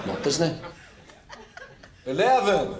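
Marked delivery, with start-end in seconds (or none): 2.1-2.7 higher-pitched, badly faked American; American attempt gone Irish; attempted American accent. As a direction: try hard to sound American. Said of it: "You need to try an American accent".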